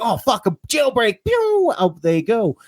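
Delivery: high, drawn-out whoop of escape; excited cat jailbreak. Acted. Owner acts out cats dashing for the open door, excited.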